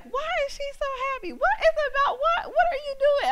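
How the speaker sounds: very high, rapid curious squeal; excited onlookers. Retold or imitated. Onlookers wondering at her happiness, high excited questions. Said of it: "there's an excitement".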